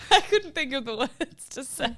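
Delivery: squeaky laughter breaking into the words; giggling through the line; laughing. Real, not acted. Laughing at her own remark.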